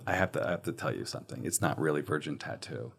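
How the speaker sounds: low-volume reveal, almost confiding; hushed confession. Retold or imitated. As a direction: break the secret gently under the breath. Breaking the prank news.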